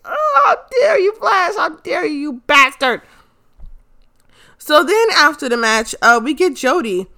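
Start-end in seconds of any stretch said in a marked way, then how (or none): 0.1-3.0 hammed-up fan fury at the villain; outraged cry. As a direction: scold the wrestler through the TV, voice cracking. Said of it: "I was just like".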